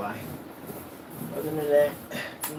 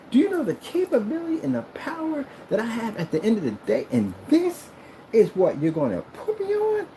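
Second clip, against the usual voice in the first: much higher, indignant mock tirade; Samuel Jackson; 13 semitones higher than usual. Projector berating owner for a bad screen, outraged rant. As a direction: rant like an insulted diva, pitched way up. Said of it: "your projectors would sound like Samuel Jackson".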